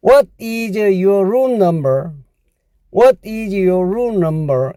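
very slow, careful English; slow model sentence. Shown as a demonstration. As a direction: read slowly for learners. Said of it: "영어로 어떻게 합니까".

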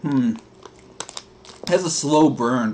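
chewing between words; talking while chewing. Real, not acted. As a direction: mouth partly full. Seen 0: Chip eaten just before; jaw and lips show chewing through these words.

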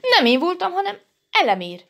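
high-pitched, hasty protest; defensive denial. A narrator's reading. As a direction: shift the blame in a high voice. Dinnye passes on the blame.

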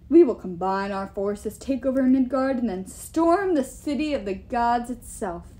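grandiose giant, with loud bursts; proud giant king. Acted. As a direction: declaim your war plan like a king.

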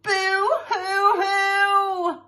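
loud, high, very long boo-hoo; mock bawling. Family burst into floods of tears, mock wailing. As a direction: wail out hammed-up sobs. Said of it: "burst into floods of tears".

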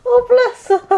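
high cooing pet voice; high sympathetic coo. Real, not acted. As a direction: coo at a frightened puppy. Comforting scared dog.

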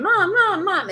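high, loud whiny calls of Mom; child calling for mom over and over; nagging toddler. Retold or imitated. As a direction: pester like a three-year-old. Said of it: "a three-year-old kid who keeps saying".

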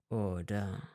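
low, soft flat question; toneless. A narrator's reading. Malfoy to Hermine, low toneless. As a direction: ask it without feeling. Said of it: "fragte er tonlos".

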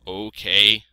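loud sheep voice; Ma the sheep, agreeing. A narrator's reading.

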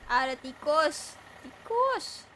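high alarmed cry; startled squeal. Real, not acted. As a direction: yelp at the rat. Spots a rat.

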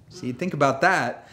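ordinary talk, nothing marked

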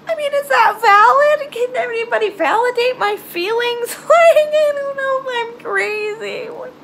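nervous laugh asking viewers for support; anxious laughing plea. Real, not acted. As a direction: ask them, half laughing, half desperate. Pleading for validation, laughing.

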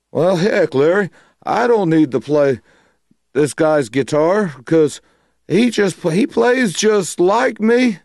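high-pitched imitation of a bluesman's teasing. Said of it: "He goes".

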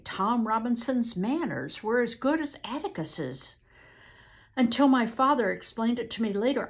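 plain reading voice

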